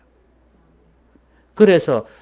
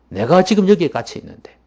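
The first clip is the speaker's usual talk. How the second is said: raised, louder acted reproach; higher, insistent chiding; emphatic rebuke; 4 semitones higher, 7 dB louder than usual. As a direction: drive the reproof home forcefully. Jesus quote repeated with force.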